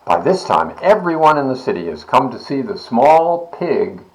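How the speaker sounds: plain reading voice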